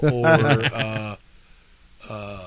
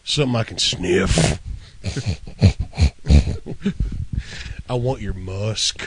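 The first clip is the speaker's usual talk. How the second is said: low creepy voice; 7 semitones lower than usual. Pretend. Voicing a dog character.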